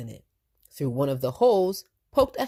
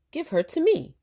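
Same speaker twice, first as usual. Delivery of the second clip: teasing officer; 12 semitones higher, 4 dB louder than usual. Acted. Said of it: "said Officer Devereaux".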